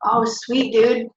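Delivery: normal talking voice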